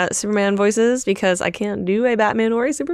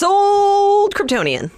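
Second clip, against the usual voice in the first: higher, slow, drawn-out proclamation; playful hype; 10 semitones higher, much slower than usual. Real, not acted. Co-host gleefully agreeing to borrow the comic. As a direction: stretch it like a game-show win.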